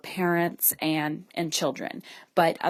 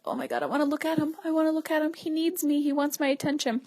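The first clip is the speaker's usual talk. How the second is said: much higher, fast, squealing with longing; giddy yearning inner voice; 9 semitones higher, faster than usual. Retold or imitated. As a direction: gush like you can't resist the puppy.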